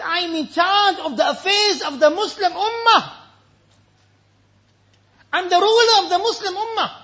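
high, forceful quoted voice; ringing preacher's quote; impassioned declaration. Acted. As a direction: proclaim the weight of the office. Caliph's burdened answer, acted.

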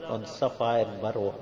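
soft, spent supplication; subdued grief. Real, not acted. Sinks down after weeping.

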